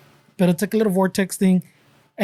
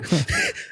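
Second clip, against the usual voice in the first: high burst of laughter; 5 dB quieter than usual. Real, not acted.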